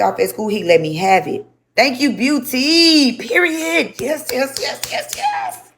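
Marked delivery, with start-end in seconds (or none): 1.8-5.7 high excited squeal, rising higher; excited shout-out. Real, not acted. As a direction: gush thanks, climbing into squeaky yeses. Thanking a viewer mid-stream.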